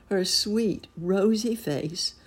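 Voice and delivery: plain reading voice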